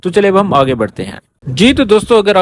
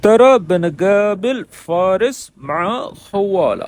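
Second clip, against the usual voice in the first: slow careful repeat; 2 semitones higher, much slower than usual. Shown as a demonstration. Teacher repeating the clip line for learners, slowly. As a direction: say ترى بنقابل فارس مع خوالة slowly, word by word. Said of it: "repeats the played Arabic line".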